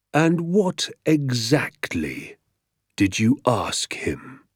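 very slow, measured menace; quietly dangerous. A narrator's reading. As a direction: draw each word out as a quiet threat. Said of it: "speaking more quietly now and far more dangerously".

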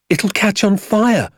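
high, drawn-out cry of alarm; high-pitched, urgent boy's cry. A narrator's reading. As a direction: cry out a warning as the excited boy. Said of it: "I cried".

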